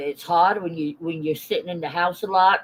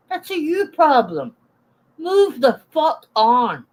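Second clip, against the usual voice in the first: exasperated telling-off; 6 semitones higher, 4 dB louder than usual. Real, not acted. Telling critics off.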